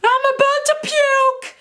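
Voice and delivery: put-on high, slow, drawn-out drunk wail; drunk girl yelling. Drunk girl at the party, high drawn-out wail. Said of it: "I hear".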